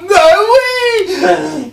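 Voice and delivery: very high, loud outcry; exclaiming shout. Acted. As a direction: yell it out high and long. Exclaims at being caught.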